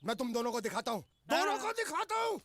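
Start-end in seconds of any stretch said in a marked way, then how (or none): 1.3-2.4 high-pitched angry repeat; worked-up threat. Acted. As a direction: threaten them again, voice shooting up.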